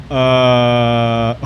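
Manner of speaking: a stunned uh, then a breathy laugh; mock stammering laugh. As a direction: imitate a stumbling reader. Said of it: "my imitation of Nick reading the review".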